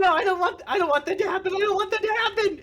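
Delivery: very high, babbling plea; high-pitched repeated panic; terrified goblin. Acted. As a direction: beg in a squealing goblin voice. Terrified goblin begs before nailing.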